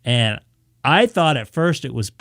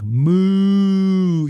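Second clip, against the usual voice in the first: long, higher-pitched imitated moo; mock cow call; 6 semitones higher, 4 dB louder than usual.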